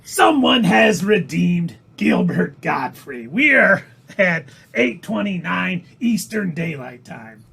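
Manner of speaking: exaggerated celebrity impersonation, drawing out key words; Gilbert Gottfried. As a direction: announce it like an abrasive comedian. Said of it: "if I kept doing this impersonation".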